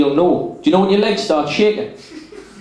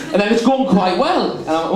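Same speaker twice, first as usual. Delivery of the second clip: ordinary delivery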